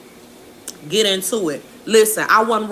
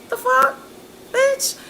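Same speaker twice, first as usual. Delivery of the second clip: outraged disbelief. Real, not acted. Outraged reaction.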